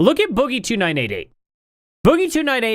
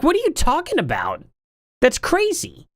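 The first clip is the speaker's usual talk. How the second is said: high, incredulous outburst; pitched-up disbelief at clip channels; mock disbelief; 11 semitones higher than usual. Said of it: "It's like".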